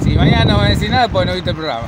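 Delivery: loud, fast over wind; shouting over wind. Real, not acted. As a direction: shout it quickly over gusts. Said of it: "está terrible el viento".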